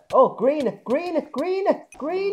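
rising alarmed shouts; panicked yelling. Real, not acted. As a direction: call it out in panic. Panicked calling out in game.

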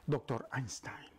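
ordinary delivery

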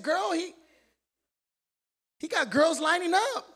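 bragging to his wife, high and loud; proud boast. Retold or imitated. Preacher boasting to his wife about their son. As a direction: show off about your son. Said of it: "I said, I said".

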